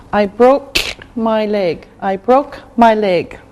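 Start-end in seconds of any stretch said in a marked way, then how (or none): none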